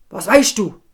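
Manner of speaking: a king losing patience, loud; irritated king. Acted. King irritated with the hideous figure, loud snap. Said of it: "sagt der König ganz gereizt".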